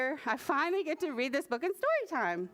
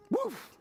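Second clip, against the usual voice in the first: sudden high bark; short, high-pitched imitation dog bark; shrieked woof; 9 semitones higher than usual. A narrator's reading. Little bird trying to find his song, shrieking a woof. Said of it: "opened his beak one day and shrieked".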